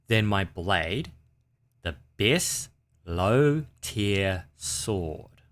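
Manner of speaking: slow, deliberate, each word spelled out; slow repeat. Shown as a demonstration. Repeating the sword review slowly for viewers. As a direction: say it slowly so nobody misses it. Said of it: "I'm gonna repeat that slow".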